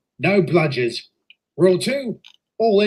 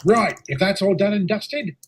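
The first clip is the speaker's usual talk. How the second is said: ordinary talk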